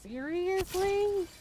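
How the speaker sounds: slow, stretched, high disbelief; incredulous. Real, not acted. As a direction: drag it out, can't believe it.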